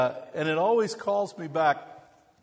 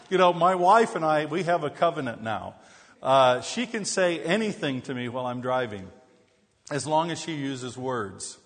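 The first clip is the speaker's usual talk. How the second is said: usual speaking voice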